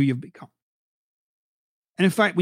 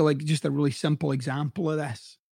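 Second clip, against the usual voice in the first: ordinary talk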